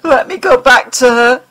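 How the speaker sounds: tearful plea. Acted. Mulder begs the alien to return him to Scully, tearful. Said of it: "his sobs choked him".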